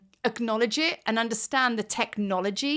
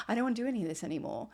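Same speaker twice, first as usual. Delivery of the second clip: fast mock whine; 8 dB quieter, much faster than usual. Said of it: "you start going".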